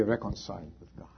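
fast urgent whisper; breathless whispered warning. Acted. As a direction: hush it like a secret. Sermon drops to urgent aside.

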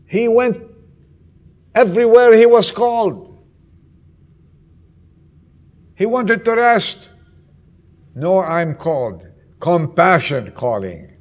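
loud, urgent sermon telling; fervent preaching. Acted. Pastor to congregation, loud fervent preaching. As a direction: preach it out to the whole church.

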